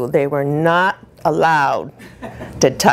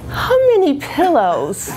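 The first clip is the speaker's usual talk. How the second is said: high-pitched mock-husband whine, laughter creeping in; higher, playful imitation of a whining husband; attention-seeking whine; 9 semitones higher than usual. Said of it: "he quickly jumped to two star".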